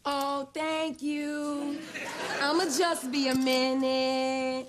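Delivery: very slow, stretched words; smug, drawn-out thanks. Acted. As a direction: take your sweet time over every word. Smug thanks after winning the quarrel.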